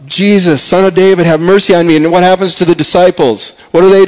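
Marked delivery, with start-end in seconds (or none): 0.1-2.0 raised, pleading cry for mercy. Acted. Blind beggar calling to Jesus by the roadside, pleading cry. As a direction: call out higher, begging. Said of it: "he's crying out".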